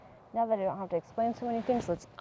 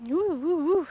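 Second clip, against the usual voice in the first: excited woo; 9 semitones higher, 8 dB louder than usual. Real, not acted. Dizzy woo during balance.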